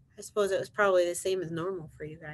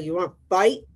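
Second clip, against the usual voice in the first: louder storybook challenge, rising at the end; grouchy ladybug; 7 dB louder than usual. Acted. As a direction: pick a fight as a grumpy bug. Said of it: "said the grouchy ladybug".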